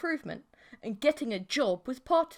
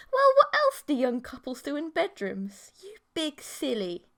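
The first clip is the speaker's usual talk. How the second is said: teasing; 6 semitones higher, 6 dB louder than usual. Acted. Emily teasing her boyfriend.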